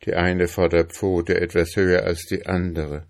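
ordinary delivery, nothing marked

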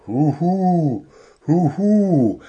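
high, drawn-out owl hoot; little owl calling out. A narrator's reading. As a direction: hoot it out, each call rising then falling. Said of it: "rief Willi, der kleine Kauz".

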